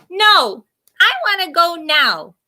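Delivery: put-on high, stubborn child's whine; whiny little sister. A narrator's reading. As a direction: whine it like a sulky little girl.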